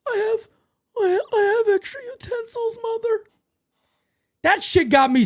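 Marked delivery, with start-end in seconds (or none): 0.1-3.2 high, weepy mocking whimper; crying Ed Gein impression. Streamer mocking the killer's voice from the show, high and weepy. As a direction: whine it high like a sobbing man-child. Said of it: "I couldn't get past The Voice".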